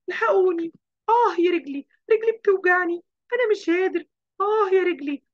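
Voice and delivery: put-on high, loud wails of a hurt child; crying out in pain. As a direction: yell for help, clutching a sore leg. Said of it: "قعد يصوت".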